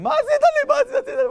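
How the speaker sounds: very high, loud mock scream; hammed-up frantic yell; going berserk. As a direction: shriek it like a father losing it. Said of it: "התחיל להשתולל".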